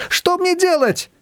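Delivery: high, slow helpless cry; high plea. A narrator's reading. Despairing question. (высокий медленный жалобный вопрос)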